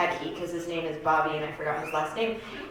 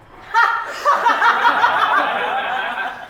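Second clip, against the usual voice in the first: loud, high burst of laughter; 13 semitones higher, 6 dB louder than usual. Real, not acted. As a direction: laugh out loud at your own outrageous line. Laughing at own abortion line.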